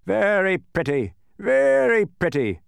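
slow, high, loud mock admiration; scowling sarcasm. A narrator's reading. Policeman surveying the wreckage, scowling sarcasm. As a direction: drag out each word scornfully. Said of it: "he scowled".

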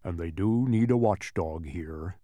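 low, sad dog character voice; sad watchdog. A narrator's reading. Watchdog explains why he must stay, sad. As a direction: speak low and sorrowful, as the dog. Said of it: "barked Tok sadly".

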